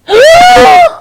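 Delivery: loud high shocked gasp; dramatic gasp. Acted. Storyteller as the witch appears, big gasp. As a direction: gasp in mock horror.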